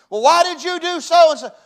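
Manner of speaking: high loud slowed mock rant; a husband shouting out his frustration; angry husband. Husband snapping back at a loving wife, loud and high.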